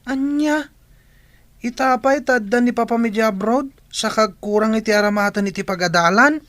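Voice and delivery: high, incredulous demand; can't believe what she hears; shocked disbelief. Acted.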